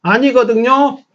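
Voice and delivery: high, slow teasing "not so"; sulky mock retort. Pastor jokingly voices a congregation that refuses to repeat. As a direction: play a stubborn kid refusing. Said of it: "따라하십시다".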